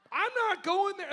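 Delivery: high, loud, laughing protest; laughing refusal. Retold or imitated. Brushing off the doubters with a high laughing protest. His own reply, laughing.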